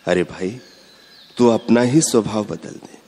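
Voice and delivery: ordinary delivery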